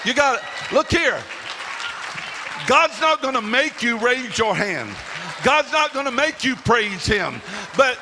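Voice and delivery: high, loud, impassioned preaching; full-force preaching. Real, not acted. Preacher urging the congregation to praise, full-force shouted preaching.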